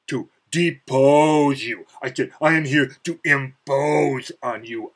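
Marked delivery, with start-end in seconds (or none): 0.1-1.9 slow, louder, higher, each word weighed; grandiose declaration. Retold or imitated. Boasting to a rival bodybuilder, a slow grand proclamation. 2.4-4.9 slow mock-heroic boast, higher and louder; grandiose declaration. Challenging the rival bodybuilder, slow mock-heroic boast.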